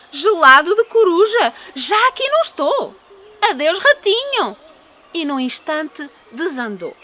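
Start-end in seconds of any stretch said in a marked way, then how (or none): 0.1-4.6 high, loud, alarmed owl; frightened owl. Acted. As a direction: shriek in fright and flee.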